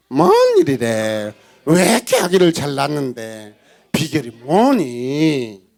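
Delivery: loud, drawn-out gossip in amazement; astonished nosiness. Acted. Village women asking Leah her secret, exaggerated astonishment. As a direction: stretch each question, hammed up.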